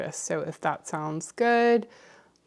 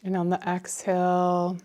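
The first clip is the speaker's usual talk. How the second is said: guided meditation. Acted. Meditation leader inviting listeners to fill their cup, calm and unhurried. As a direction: guide them gently, phrase by phrase, leaving space.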